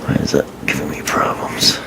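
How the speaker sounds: whispered frustrated aside under his breath; muttered to himself. Real, not acted. Reviewer fighting a stubborn part, muttering to himself. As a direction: mutter it to yourself while fiddling with the part. Struggling to fit a toy part.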